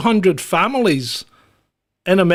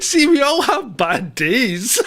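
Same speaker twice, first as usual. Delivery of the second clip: laughing; 7 semitones higher, slower than usual. Real, not acted. Laughing while repeating the line.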